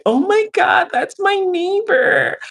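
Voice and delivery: a hammed-up, squeaky fake gasp; mock shrill voice. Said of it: "I don't say".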